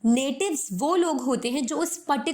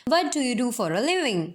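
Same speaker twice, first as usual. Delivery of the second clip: words merged into one quick run; fast American; much slower than usual. Shown as a demonstration. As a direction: blend "What do you do for a living?" together fast. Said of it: "आप देखेंगे आपको ये words individually clear नहीं हुए होंगे". (शब्दों को जोड़कर तेज़ बोलें)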